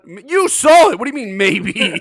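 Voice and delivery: high, loud incredulous challenge. Real, not acted. Co-host challenging a vague theatre memory, incredulous. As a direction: pounce on the vague answer in disbelief. Challenging co-host's unsure answer.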